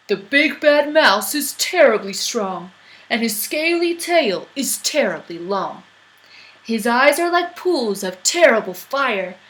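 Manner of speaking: big booming monster voice; loud, heavy beast boasting; Gruffalo voice. Acted. Gruffalo voice in story.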